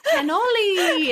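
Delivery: cannoli sung high and slow; sing-song code word. Pretend. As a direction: lilt the word like a sweet nothing. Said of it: "And if I say, like".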